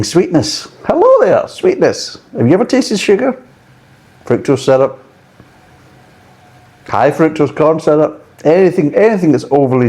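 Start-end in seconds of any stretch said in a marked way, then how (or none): 0.8-2.2 very high squeaky "Hello there, sweetness"; hammed-up squeaky greeting to the sweetness; mock greeting. 2.4-9.3 mocking high voice.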